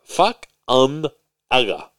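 Fukunaga drawn out very slowly; careful pronunciation. Shown as a demonstration. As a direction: break Fukunaga into slow, separate chunks. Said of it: "be very careful how you pronounce that".

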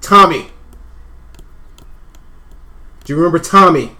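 loud insistent questioning; heated family shouting. Acted. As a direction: press her loudly about Tommy. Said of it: "Stop yelling at me".

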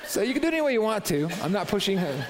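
high and loud, still laughing. Real, not acted. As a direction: laugh it off and move on. Still laughing after story.

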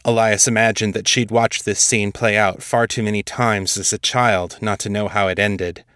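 ordinary delivery

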